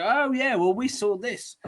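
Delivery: high-pitched mocking imitation of credulous people; mock gullible people. Host mimics people who don't look into things, high mocking voice. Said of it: "and they just go".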